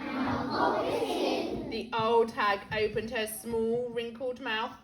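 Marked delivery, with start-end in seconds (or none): 0.1-1.7 slow whisper; whispered echo. Acted. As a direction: breathe the line back in a whisper.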